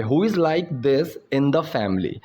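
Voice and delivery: slow, deliberate example sentence; slow teaching demo. Shown as a demonstration. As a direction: lay each word out slowly. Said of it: "So यहाँ पे". (धीरे-धीरे समझाते हुए)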